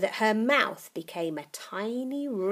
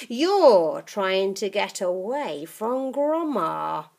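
put-on sly old witch; 2 semitones higher, 9 dB louder, slower than usual. Grandma accusing.

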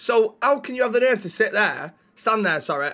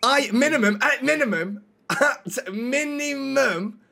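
MINIMUM pitched high and drawn out; emphatic insistence; 6 semitones higher, slower than usual. Acted. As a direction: hammer MINIMUM, high and stretched. Arguing for higher rank.

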